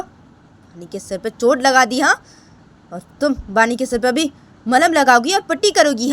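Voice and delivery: sharp scolding, starting high; dismissive, angry younger mother. Acted. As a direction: order her out of the way sternly. (गुस्से में झिड़कती छोटी माँ)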